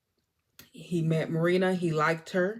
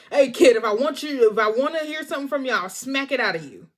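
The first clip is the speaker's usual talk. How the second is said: mock threat; 5 semitones higher, 6 dB louder, faster than usual. Rocco snaps at kid.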